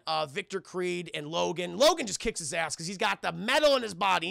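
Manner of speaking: ordinary talk, nothing marked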